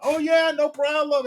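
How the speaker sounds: very high, slow chirpy customer voice; cheery customer. Retold or imitated. Customer happily offering dog snacks, very high and slow. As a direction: sing it out like an eager dog owner.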